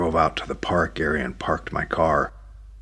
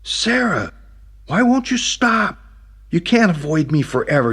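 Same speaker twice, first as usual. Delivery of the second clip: pleading call; 11 semitones higher, 5 dB louder than usual. Acted. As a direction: beg her to stop avoiding him. Catching up, pleading loudly.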